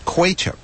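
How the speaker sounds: "Quachup" set out alone as KWAY-chup; pronounced KWAY-chup. Shown as a demonstration. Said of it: "how Wikipedia says you should pronounce it".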